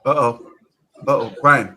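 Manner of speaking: loud mock uh-oh; mock alarm. Streamer reacting to Brian's emotion, loud mock alarm. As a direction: sound the alarm, hammed up. Clip guy getting emotional.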